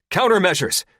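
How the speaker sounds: high, loud command; yell. Acted. Pilot yells an order during the chase, urgent. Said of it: "Porthos yells".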